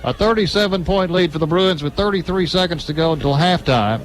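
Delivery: ordinary delivery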